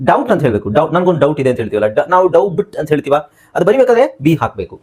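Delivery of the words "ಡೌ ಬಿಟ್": ಡೌ ಬಿಟ್ in two beats with a b; b wrongly sounded. Shown as a demonstration. Teacher quotes the wrong reading of doubt, b sounded. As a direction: break it as ಡೌ ಬಿಟ್, voicing the b. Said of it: "ಡೌ ಬಿಟ್ ಅಂತ ಉಚ್ಚಾರಣೆ ಮಾಡಬಾರ್ದು".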